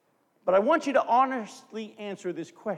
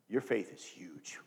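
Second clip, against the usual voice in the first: much lower, much softer, slow; tender praise; 10 semitones lower, 10 dB quieter, slower than usual. Retold or imitated. Jesus praising the woman, soft and tender. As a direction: say it with quiet awe.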